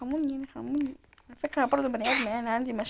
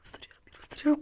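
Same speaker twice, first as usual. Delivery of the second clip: ordinary talk, nothing marked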